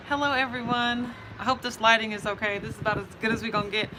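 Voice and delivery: usual speaking voice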